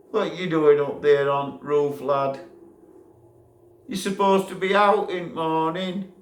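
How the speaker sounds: loud, high yelling up; shouted call. Retold or imitated. Shouting at the lad on the roof, loud high yell. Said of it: "And I shouted".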